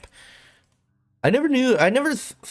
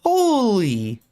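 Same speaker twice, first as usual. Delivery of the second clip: amazed; 9 semitones higher than usual. Real, not acted. Amazed at the money.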